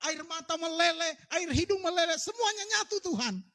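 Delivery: mock whiny complaint. Mock whiny prayer about unanswered prayer.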